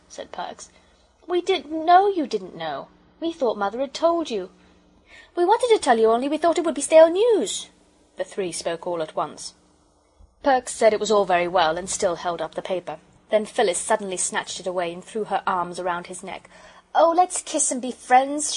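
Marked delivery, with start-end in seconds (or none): 1.3-7.7 put-on high, loud childlike pleading; pitched-up, eager protest; protesting children. 16.9-18.6 put-on high, loud, coaxing little girl; slow, pitched-up appeal; pleading girl.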